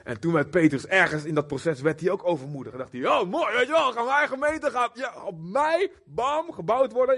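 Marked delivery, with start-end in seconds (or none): 3.1-7.2 cocky, higher voice, self-satisfied; boastful Peter. Acted. Peter boasting that the church is built on him, cocky. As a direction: brag like someone who thinks he's made it.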